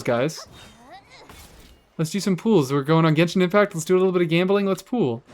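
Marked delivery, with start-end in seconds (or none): 2.0-5.2 "pools" said like pull, higher pitched; hammed-up Midwestern "pools"; mock Midwestern pools. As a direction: tease the Midwestern "pools" in a high voice. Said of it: "You say pull like pool".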